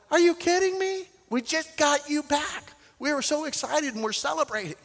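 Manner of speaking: high, disbelieving protest as the disciples; incredulous disciples. Retold or imitated. Disciples protesting to Jesus, high and incredulous. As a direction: pitch up in shock at losing him again.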